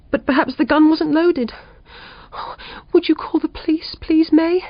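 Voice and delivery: shaken June. A narrator's reading. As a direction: voice June still trembling after the burglar. June voiced higher.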